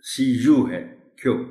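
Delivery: ordinary talk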